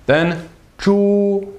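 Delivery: zhu held high and level in first tone. Shown as a demonstration. Teacher models zhu for learners, high level tone. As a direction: keep zhu high and flat. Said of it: "first tone".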